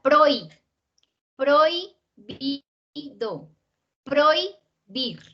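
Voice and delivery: "prohi" as one beat, the rest syllable by syllable; one single syllable. Shown as a demonstration. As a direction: break up "prohibido" and "Prohibir", keeping "proi" whole. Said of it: "esta es una sola".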